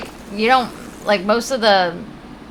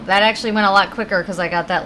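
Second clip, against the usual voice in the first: ordinary talk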